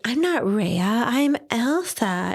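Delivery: put-on high, slow lisping toddler. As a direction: lisp it like a little girl. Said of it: "Here with her little lisp".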